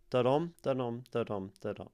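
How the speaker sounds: four steady "da-dum" beats, weight on "dum"; four iambs. Shown as a demonstration. Teacher sounding out iambic tetrameter, steady iambic beat. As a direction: tap out "da-dum" four times, weak then strong. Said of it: "which is four iambs".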